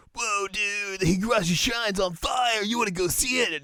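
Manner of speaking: put-on gawk like a thrilled skater kid; high-pitched goofy skater impression; excited skater dude. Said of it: "these skaters are like".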